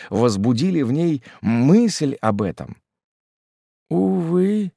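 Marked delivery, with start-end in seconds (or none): 3.9-4.7 sad sigh. A narrator's reading. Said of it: "сказала она печально".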